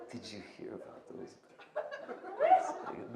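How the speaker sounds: very soft, quick, almost inaudible delivery; hushed joke opener. Shown as a demonstration. Comedian slipping in the hack opener he just mocked, hushed. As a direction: sneak it out very softly, breaking into a squeak.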